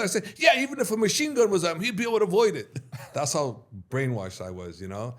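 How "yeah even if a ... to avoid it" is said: high, loud heated insistence; pissed insisting. Retold or imitated. Arguing with brother while pissed, heated loud insistence. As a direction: defend it hotly, pitch up. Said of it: "I even got so pissed".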